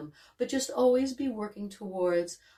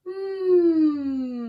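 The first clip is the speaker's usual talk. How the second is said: gliding hum; 9 semitones higher than usual. Shown as a demonstration. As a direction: hum sliding high and down. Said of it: "let that pitch glide grow".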